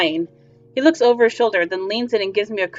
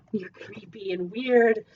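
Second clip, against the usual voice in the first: whisper; 8 dB quieter, slower than usual. Acted. Player whispers a jab at stranger.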